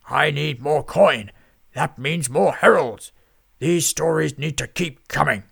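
loud, slow booming demand; pompous boss wanting coin; greedy lord. A narrator's reading.

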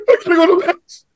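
high, squeaky panicked inner voice; mock-fearful high inner voice insisting on going to Mass; lizard brain voice. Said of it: "my lizard brain".

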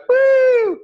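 high, drawn-out "woo"; podekscytowany okrzyk. Retold or imitated. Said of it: "like, we're excited".

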